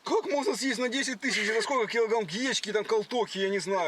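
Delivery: loud, high, disbelieving; shocked outburst. Acted. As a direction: blurt it out in shock. Shocked by restaurant bill.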